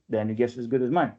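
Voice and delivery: ordinary delivery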